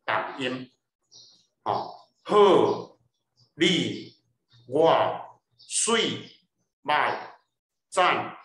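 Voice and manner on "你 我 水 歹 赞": 你 我 水 歹 赞 each hit hard, louder and higher; heavy tone demo. Shown as a demonstration. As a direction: punch each syllable like a heavy strike. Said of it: "是足重足重的音".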